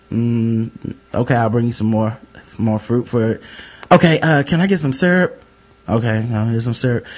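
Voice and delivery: low, soft, fed-up mutter; grudging server. Retold or imitated.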